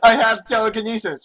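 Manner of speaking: laughing read. Real, not acted. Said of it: "he answered cruelly".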